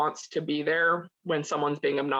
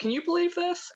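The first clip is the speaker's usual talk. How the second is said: high mocking quote; 13 semitones higher than usual. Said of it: "they're like".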